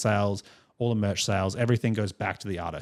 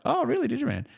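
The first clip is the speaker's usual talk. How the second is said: much higher, playfully amazed 'Oh, really?'; mock surprise. Teasing a stranger on the train who claims band membership, mock surprised. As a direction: fake being impressed, pitch leaping up.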